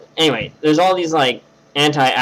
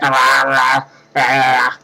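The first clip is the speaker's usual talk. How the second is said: open-mouthed "rah" warm-up noises; jaw-stretching noises; slower than usual. Retold or imitated. Storyteller imitating friend readying for the contest, jaw-stretching noises. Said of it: "he's stretching his jaw out".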